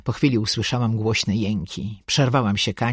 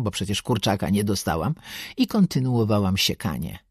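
plain reading voice

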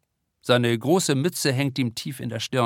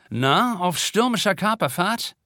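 teacher voice; 7 semitones higher than usual. A narrator's reading. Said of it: "kommt ihnen Junglehrerin Vanessa Löbel entgegen".

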